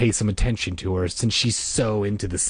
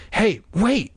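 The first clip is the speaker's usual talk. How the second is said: put-on high-pitched kid calling out; crying out; 12 semitones higher than usual. A narrator's reading. Casey calling after the girls, crying out. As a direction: cry out so they wait. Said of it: "Casey cried".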